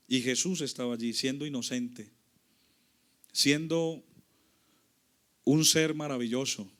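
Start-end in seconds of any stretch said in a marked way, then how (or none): none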